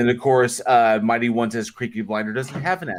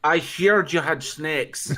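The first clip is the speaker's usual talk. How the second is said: slow mock Irish "I heard you had snakes", with "heard" drawn out; a playful put-on Irish accent; 3 semitones higher, slower than usual. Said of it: "trying to save them from the snakes".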